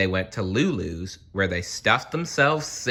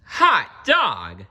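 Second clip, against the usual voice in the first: higher, loud joyful shout; excited cry; 8 semitones higher, 7 dB louder than usual. A narrator's reading. Kids welcoming Miss Nelson back, a loud happy whoop. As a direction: cheer at her return. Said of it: "cried the kids".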